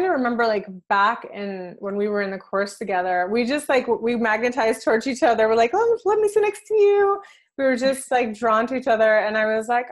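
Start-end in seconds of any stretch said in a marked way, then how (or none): 5.7-7.2 higher, louder eager re-enactment; eager mock greeting. Friend re-enacting meeting her galactic sister, eager and high. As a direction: act out a keen, high-pitched invitation. Acting out instant friendly attraction.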